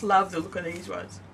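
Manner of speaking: yawning through the words. Real, not acted. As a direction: talk mid-yawn, tired. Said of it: "There's that yawning again".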